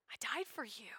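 unvoiced, tender whisper. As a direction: breathe it as a gentle answer. Jesus answers in whisper.

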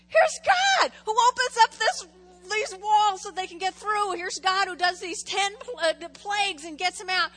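loud, high sermon shout; preaching at full force. Acted. Pastor booming about God's miracles to the church. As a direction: proclaim God's power with fire.

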